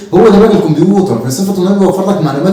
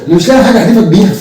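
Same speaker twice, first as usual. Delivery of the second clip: ordinary delivery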